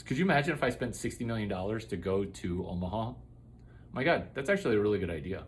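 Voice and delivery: quick, low, soft aside; muttered aside. Pretend. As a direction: mumble it fast under your breath.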